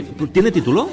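normal talking voice

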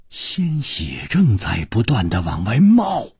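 ordinary delivery, nothing marked

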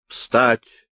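sharp, loud barked order; loud military command; soldierly bark. A narrator's reading. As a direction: bark it like a drill sergeant. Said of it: "рявкнул Миних по-солдатски".

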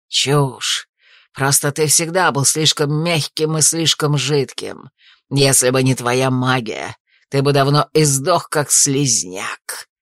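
low, hissed contempt from an assassin; ninja whisper. A narrator's reading. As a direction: hiss it low and slow, sneering. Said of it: "Тихо прошипел убийца".

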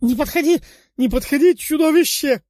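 high, loud panicked shriek; terrified scream. A narrator's reading. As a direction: scream in terror at the monster. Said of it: "кричал он".

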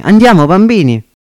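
put-on rally the kids to hurry; calling out. Marcovaldo urges his children toward bigger mushrooms, loud call.

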